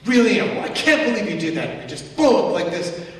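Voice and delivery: loud exasperated blow-up, imitating rage; mock angry outburst. Preacher imitating someone snapping in anger. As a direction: act out a sudden flare of temper.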